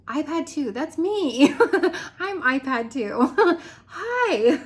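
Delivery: nervous fan laughing while saying hi; laughing starstruck. Retold or imitated. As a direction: giggle out your introduction, starstruck. Starstruck intro on the Zoom call.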